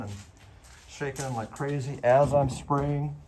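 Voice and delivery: muffled behind a mask; through a face mask. Real, not acted. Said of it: "My voice might be a little bit muffled".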